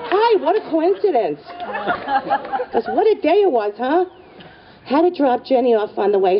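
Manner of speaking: high-pitched, overly friendly greeting; imitated chirpy hello; chatty acquaintance. Bob greets them in awkward surprise.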